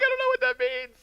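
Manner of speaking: high-pitched panicked reply; flustered cry. Retold or imitated. Novice answering his buddy's coaching, flustered and high.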